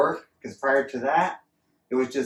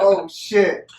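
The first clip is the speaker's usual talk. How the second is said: loud, high oh shit; startled, pitched up and louder; realizing they are stuck with the motor; surprised; 7 semitones higher, 7 dB louder than usual. Retold or imitated. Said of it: "I was like".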